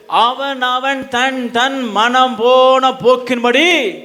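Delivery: high, ringing sermon delivery, each phrase driven home; full-force preaching. Real, not acted. Preacher quoting scripture on wayward Israel, full-force preaching. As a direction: proclaim it to the whole congregation.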